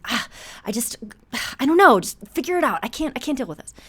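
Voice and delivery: imitating a frazzled husband boiling over; overwhelmed outburst. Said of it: "and he's".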